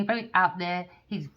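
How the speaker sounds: ordinary delivery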